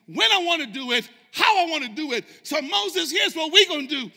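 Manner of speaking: loud, high-pitched preacher voicing God's authority; God's booming declaration. God warning Moses he can start over, booming. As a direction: thunder it out as God laying down the law. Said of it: "it's as if God's saying".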